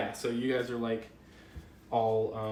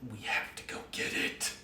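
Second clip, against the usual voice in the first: creepy whisper; 7 dB quieter than usual. Acted. Snake voice through player.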